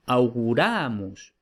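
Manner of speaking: auguramus stressed on ra, au-gu-RA-mus, said slowly; Latin stress demo. Shown as a demonstration. Teacher showing students the stress in auguramus, slowly. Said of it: "vemos que es auguro, pero es".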